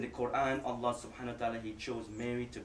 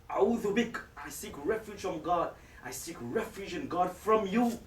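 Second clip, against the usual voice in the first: higher, louder, urgent cry for protection; frightened plea; 6 semitones higher, 4 dB louder than usual. Acted. Mary begs God for refuge from the stranger, a frightened plea. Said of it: "and she's scared".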